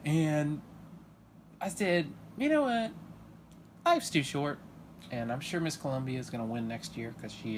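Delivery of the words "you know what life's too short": high, loud, breezy shrug-off; mock magnanimous. Bribed viewer forgives the network, loud breezy mock shrug. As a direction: wave it off grandly. Accepting pageant swag.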